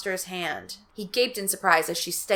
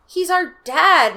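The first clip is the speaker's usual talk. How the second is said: usual speaking voice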